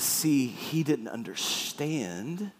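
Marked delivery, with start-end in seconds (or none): none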